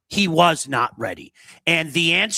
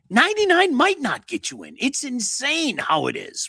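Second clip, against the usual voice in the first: high, strained incredulous pitch; worked-up disbelief; 10 semitones higher than usual. Real, not acted. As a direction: stretch it out, amazed. Worked up over playoff race.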